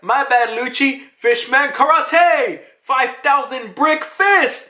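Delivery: high, drawn-out battle call; acted attack cry. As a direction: announce a special move, anime style. Voices Jinbei announcing a special attack.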